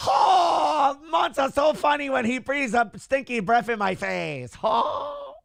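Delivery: high, hammed-up anime character shout; Anime Aaron voice. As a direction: squeal it like a silly anime hero. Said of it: "that's Anime Aaron face".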